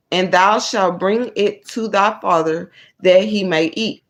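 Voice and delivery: loud, measured Bible reading; emphatic read-aloud of scripture. Acted. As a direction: read the verses out firmly, phrase by phrase with pauses. Reads scripture aloud to viewers.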